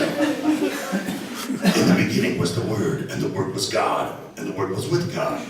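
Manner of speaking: low, measured masked-villain voice; Darth Vader. Acted. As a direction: intone it deep and solemn, like a dark lord. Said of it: "that would be cool as Darth Vader".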